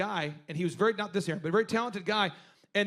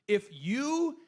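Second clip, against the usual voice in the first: drawn out; 4 semitones higher, much slower than usual. Real, not acted. Stretching the point slowly.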